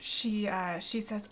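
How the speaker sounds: normal talking voice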